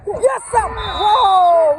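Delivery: high, loud cheer for a goal; excited cheer. Real, not acted. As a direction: cheer him on.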